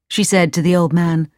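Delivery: plain reading voice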